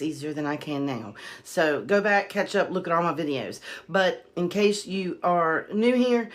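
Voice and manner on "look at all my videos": ordinary delivery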